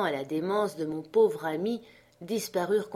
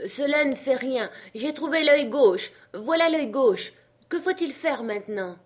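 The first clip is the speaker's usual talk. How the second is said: high, loud character voice; triumphant servant; 7 semitones higher, 5 dB louder than usual. Acted. Jupiter finds the eye and asks what next, eager. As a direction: announce the find from above.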